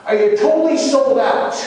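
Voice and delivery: high-pitched, intense, pressing question; voice risen high. Retold or imitated. Jesus demanding Peter's total devotion, high and intense. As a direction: ask it urgently at the top of the build. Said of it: "his voice rose each time he asked him".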